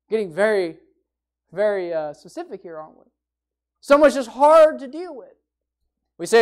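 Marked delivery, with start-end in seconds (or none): 3.8-5.4 "hard" punched loud and higher; stress on hard. Shown as a demonstration. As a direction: drive home "hard". Repeats with emphasis.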